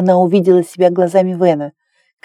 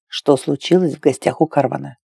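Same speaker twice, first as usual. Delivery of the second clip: low, softer man's reply; lightly voiced male lover; 5 semitones lower, 4 dB quieter than usual. A narrator's reading. Lover replies to Claire's teasing, low soft male voice. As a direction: answer low and easy.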